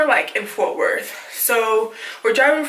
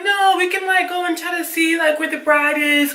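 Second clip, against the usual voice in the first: higher-pitched acted objection; retold protest; 7 semitones higher than usual. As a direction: plead to keep going, pitched up. Acted objection to the cousin leaving.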